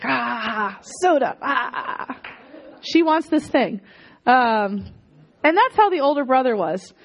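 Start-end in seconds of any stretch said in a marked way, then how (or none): 0.0-2.2 mock wailing over soda; half-laughing whine for a soda; longing child. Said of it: "it's like".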